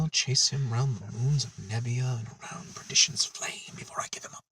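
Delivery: whispered villain quote. Retold or imitated. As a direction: breathe the threat in a whisper. Quoting Khan after Montalban mention.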